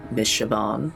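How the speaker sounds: usual speaking voice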